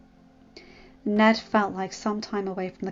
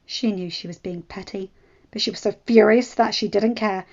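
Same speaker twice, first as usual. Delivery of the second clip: plain reading voice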